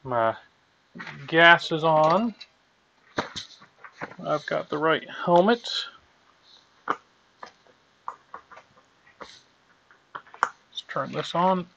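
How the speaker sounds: muffled behind a welding mask; talking inside a welding helmet. Real, not acted. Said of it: "let me get my helmet on".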